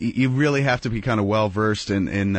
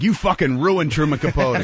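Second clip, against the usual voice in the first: indignant; 5 semitones higher, 3 dB louder than usual. Retold or imitated. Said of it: "the whole world would be like".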